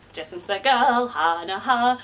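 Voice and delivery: usual speaking voice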